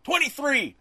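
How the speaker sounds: high, loud chanting yell. Retold or imitated. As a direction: holler it out at full pitch, falling off at the end.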